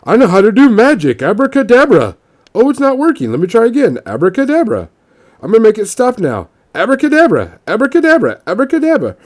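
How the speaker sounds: high childish mock-magician voice; mock a kid chanting a magic spell; kids party magician. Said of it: "The kid's even your basic trope".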